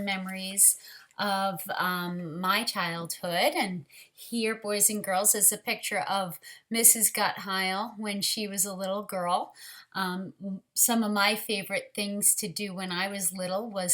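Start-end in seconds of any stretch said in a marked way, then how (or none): none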